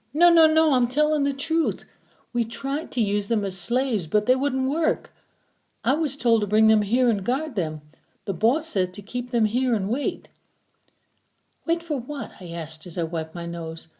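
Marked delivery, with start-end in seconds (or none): 0.1-10.3 higher, louder panicked prisoner; frightened pleading. A narrator's reading. Prisoner begging Wilcox not to shoot him, panicked and higher. 11.7-12.3 higher, lightly voiced girl; girl's retort. A narrator's reading. Narrator voicing the heroine, a higher girl's tone.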